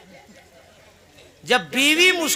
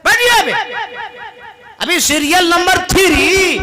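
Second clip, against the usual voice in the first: much higher, loud irritated wife voice; wife answers husband from inside, much higher and irritated; annoyed wife; 9 semitones higher, 6 dB louder than usual. Acted. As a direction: snap back from inside, put out. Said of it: "wife refusing again".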